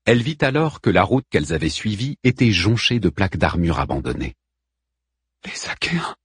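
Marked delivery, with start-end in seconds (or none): 5.5-6.1 weak, whispered last words; dying murmur. A narrator's reading. Dying woman names her attackers to the queen, faint murmur. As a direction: whisper it with failing breath. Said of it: "murmura la mourante".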